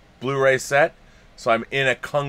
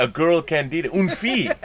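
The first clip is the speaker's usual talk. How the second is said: ordinary talk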